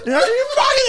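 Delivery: very high-pitched imitation of a yell; garbled high mock fury. Imitating the ready-and-waiting driver, mock angry yell. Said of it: "win a yelling match with him".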